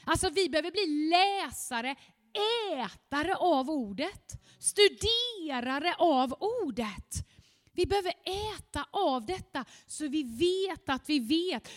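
usual speaking voice

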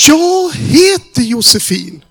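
high, loud, slow tearful cry. Retold or imitated. Young woman breaking down outside the restaurant, loud high crying. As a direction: wail the name out slowly. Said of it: "Hon bara skrek ut".

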